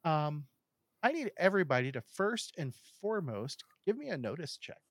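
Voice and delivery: usual speaking voice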